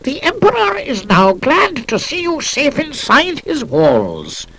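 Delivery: high, squeaky official proclamation; emperor's squeaky public voice. Acted. Janitor delivers emperor's speech to guests, high and squeaky. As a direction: squeak out the emperor's decree like a mouthpiece. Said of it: "in the squeaky voice the emperor uses in public".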